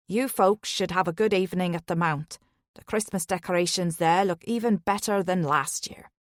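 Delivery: a friendly Irish lilt, just a hint of it. Taxi driver chatting to his passengers, light Irish lilt. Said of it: "His Irish lilt".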